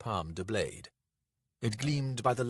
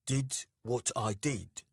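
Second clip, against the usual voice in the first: slow, menacing challenge; murderous menace; 4 semitones higher, slower than usual. A narrator's reading. Dec challenges Nanak's accusation, slow menace. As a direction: draw the question out as a threat. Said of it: "Dec's voice was murderously low".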